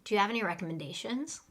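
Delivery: quick, run-together "Do you have any recommendations?"; fast natural English. Shown as a demonstration. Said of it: "But let's say it fast. Repeat after me".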